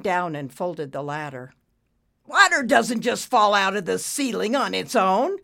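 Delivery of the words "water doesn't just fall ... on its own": loud, raised-pitch objection; irritated retort. A narrator's reading. Boss disputing maintenance man's report, irritated. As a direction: argue back crossly.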